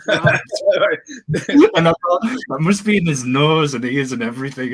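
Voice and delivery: words squeezed out high through laughter; can barely get it out for laughing. Real, not acted. Telling her friend about the shower stunt, laughing hard.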